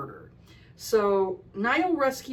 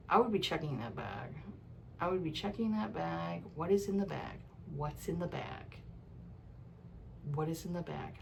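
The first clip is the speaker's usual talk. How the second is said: low hushed mutter, softer than her chat; quiet muttering; 3 semitones lower, 9 dB quieter than usual. Retold or imitated. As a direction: mutter the warning under your breath like a worried reader. Urging a dumb heroine, muttering.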